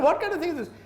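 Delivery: exasperated outburst. Retold or imitated. Sheikh to the student, exasperated high outburst.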